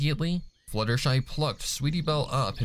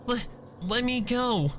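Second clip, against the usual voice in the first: much higher, panicky stammering plea; scared stammer; 13 semitones higher than usual. Acted. Sweetie Belle, trapped in a death grip, stammers in panic. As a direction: stumble over the words in panic. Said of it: "she stammered".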